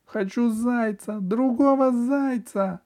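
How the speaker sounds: put-on slow, high, loud child's plea; a crying boy asks for another toy; tearful child's wish. A narrator's reading. Boy in tears asks for another rabbit. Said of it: "сказал навстречу ... светлому лучу".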